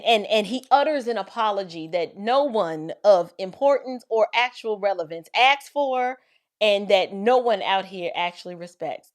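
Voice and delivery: ordinary talk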